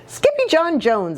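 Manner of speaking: high startled mother's cry; a mild mock exclaim from Mama. A narrator's reading. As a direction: call his full name in alarm. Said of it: "exclaimed Mama".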